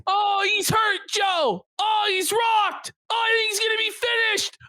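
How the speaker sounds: high, frantic fake play-by-play; mock fight commentator. Commentator pleading to the referee over a hurt fighter, mock-frantic. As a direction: call a one-sided beating in mock alarm.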